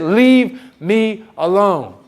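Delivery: very slow, emphatic mock exasperation; exasperated order. Woman to the man following her, slowly and firmly exasperated. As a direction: stretch each word to drive him away.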